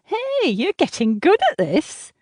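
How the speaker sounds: high, nasal encouragement; grating, nasal. A narrator's reading. Seatmate praising the American's second try, grating and nasal. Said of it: "She has a voice that grates, almost nasal".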